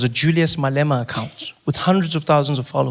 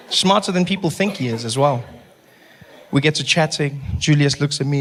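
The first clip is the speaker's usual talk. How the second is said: usual speaking voice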